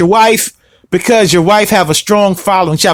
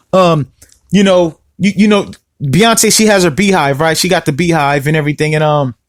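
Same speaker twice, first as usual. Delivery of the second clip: ordinary delivery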